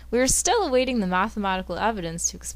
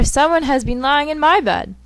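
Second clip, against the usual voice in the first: Mama Bear; 6 semitones higher, 5 dB louder than usual. Acted. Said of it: "cried Mama Bear".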